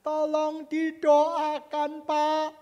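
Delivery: slow, loud, drawn-out begging for prayer; stretched-out imploring cry; plea for help. Retold or imitated. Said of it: "minta tolong".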